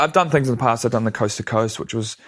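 normal talking voice

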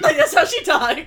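high, loud, amused insistence; excited shout. Real, not acted. Insisting that's how she talks, high excited shout. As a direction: shout it high to drive the joke home.

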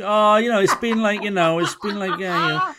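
playful mock excuse-making; mock hedging. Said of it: "She's gonna try and qualify it now".

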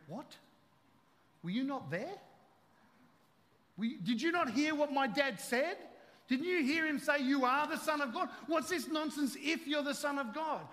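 Jesus scoffing at the devil; indignant Jesus. Retold or imitated.

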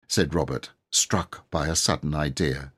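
plain reading voice

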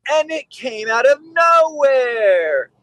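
high, slow, drawn-out disbelief; mock panicked client. Dog owner on the phone, insisting, in mock panic. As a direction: play the stunned owner crying out. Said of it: "they'll always say".

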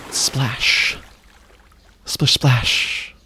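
breathy imitated splashing; whispered splash noise. Jumping into the pool alone, whispered splash sounds. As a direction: voice the splash of the water.

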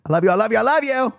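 climbing to a squeaky high love; Christmas elf. Retold or imitated. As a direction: play the cheery elf. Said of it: "Not me sounding like the elf".

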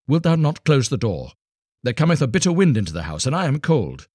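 high, quick, complaining plea; hurried and shivering, begging him to shut it; cold wife. A narrator's reading. Trembling wife begging her husband to close the door, quick.

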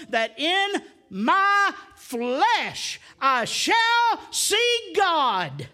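slow, loud, high proclamation; preaching shout. Acted. Preacher proclaiming Job's verse to the congregation, slow full shout. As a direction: drag it out and ring it to the rafters.

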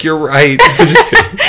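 high squealing laugh through the words; laughing joke. Real, not acted. Joking reply, then laughing.

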